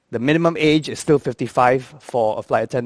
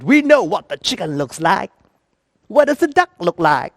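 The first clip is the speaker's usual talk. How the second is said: higher, demanding question; indignant boss; 4 semitones higher than usual. Retold or imitated. Boss standing up to question attendant, indignant and high. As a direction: challenge the attendant, pitch climbing. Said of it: "her boss stood up and said".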